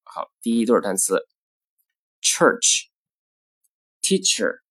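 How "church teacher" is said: slow "church" with long stressed er, "teacher" with short weak er; stressed versus weak vowel. Shown as a demonstration. Teacher showing syllable stress, slowly, er long in "church", short in "teacher". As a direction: hold the er in "church", reduce it in "teacher". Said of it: "元音在重读音节中比在弱读音".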